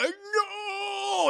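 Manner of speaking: long, high, loud "no"; hammed-up villain death wail; desperate scream. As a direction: scream it drawn out in mock horror. Acts villain as brain is destroyed.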